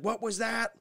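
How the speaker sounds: a clerk who didn't catch it; mock cashier. Cashier asks the wife to repeat, mock-high and curt. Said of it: "And then she's like".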